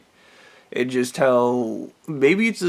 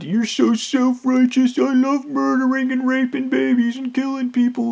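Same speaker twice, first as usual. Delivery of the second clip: mocking high voice; 12 semitones higher, 6 dB louder than usual. Mocking his critics in a high, sneering, put-on voice. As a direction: pitch it way up and sneer the accusation. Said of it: "they call it self".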